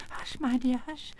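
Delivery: high, nervous little-man voice; anxious hushing. Acted. Exposed wizard afraid of being overheard, anxious hushing. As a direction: hush her urgently, pitched high.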